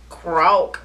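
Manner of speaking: low, short croak; toad croak. Acted. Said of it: "says the toad".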